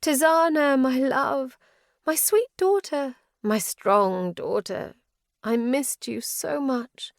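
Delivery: high, slow, yearning mother's call; longing mother. A narrator's reading. Mother's ghost calling her daughter, high and yearning. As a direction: reach out to your lost daughter.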